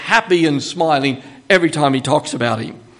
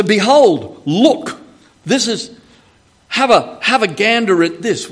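louder, higher Aussie "have a gander at this"; casual Aussie exclaim, raised and loud; Australianese paraphrase; 4 semitones higher, 5 dB louder than usual. Shown as a demonstration. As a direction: give it a broad Australian slang punch. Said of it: "we might say in Australianese".

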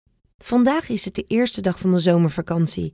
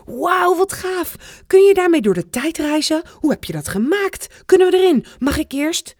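put-on higher, eager kids' voices tumbling over each other; children bursting with excitement, much higher; excited children; 11 semitones higher, 4 dB louder than usual. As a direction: squeal it out like thrilled children. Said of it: "roepen van alles door elkaar".

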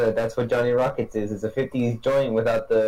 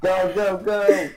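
cheering; 8 semitones higher, 3 dB louder, much slower than usual. Real, not acted. Cheering her dancing.

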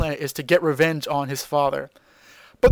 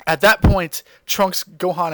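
ordinary delivery

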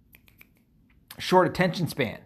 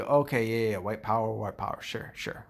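sarcastic mock agreement; 4 semitones lower, 6 dB quieter than usual. Mocking critics who took the driver literally, in a soft sarcastic tone. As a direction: roll your eyes and concede it mockingly. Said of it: "it's like, "What more can be said".

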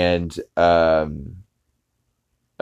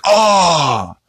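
high loud cartoon gasp; a hammed-up gasp of shock; mock shocked gasp; 11 semitones higher, 6 dB louder than usual. Speed reacts to his father's reveal with a high gasp.

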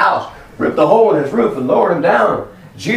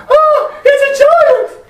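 very high, stretched-out squeal; hammed-up terror at a giant; mock panic; 4 dB louder, much slower than usual. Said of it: "and go".

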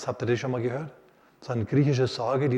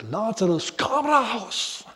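much higher, ringing command; bold commanding call; 8 semitones higher, much slower than usual. Retold or imitated. Jesus calling Lazarus from the grave, bold command. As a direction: call him out with full authority. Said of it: "Und er ruft".

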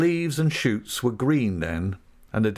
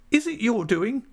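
retorting; 14 semitones higher than usual. Acted. Rosa Dartle retorting furiously at David. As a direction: throw his question back at him. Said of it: "she retorted".

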